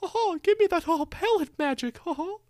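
high squeaky cartoon mouse; high silly cartoon mouse grabbing a rifle; Mickey Mouse voice.

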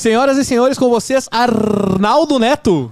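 high, ringing announcer build-up; grand entrance announcement. Acted. Host introduces guest.

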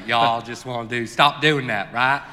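loud, hammed-up American drawl; American accent. FBI man scolding the NGO founder, loud American accent. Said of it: "I was doing American all day".

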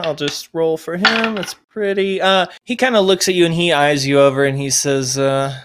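ordinary talk, nothing marked

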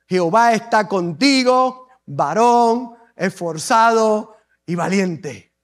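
stately angel addressing a hero; solemn angelic greeting. Acted. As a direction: draw out each title with pauses. Angel's greeting to Gedeón, declaimed.

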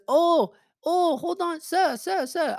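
very high, urgent mock Chinese accent; imitating Chinese-accented old lady. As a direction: call him back in a high put-on Chinese accent. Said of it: "she goes".

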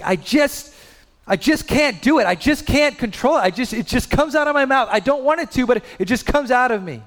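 put-on high, helpless pleading child voice; son pleading. Father voicing his son Kai pleading he can't control it. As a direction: plead like a frustrated six-year-old. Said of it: "He's just like".

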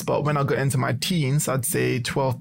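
usual speaking voice

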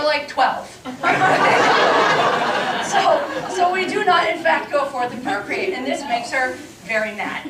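ordinary talk, nothing marked

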